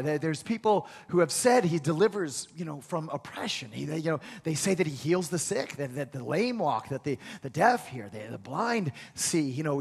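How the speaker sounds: soft, low confiding voice; gossipy hush. Acted. Neighbour quietly telling the woman about Jesus. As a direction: pass on a rumour quietly. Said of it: "Somebody told her".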